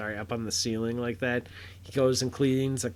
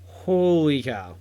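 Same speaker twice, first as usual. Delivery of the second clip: stunned exclamation; 4 semitones higher, 7 dB louder than usual. Real, not acted. Reacting to surprise cameo reveal.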